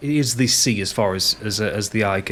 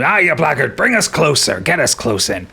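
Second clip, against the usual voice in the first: captain yells; 4 semitones higher than usual. Acted. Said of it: "he yells up at the helmsman".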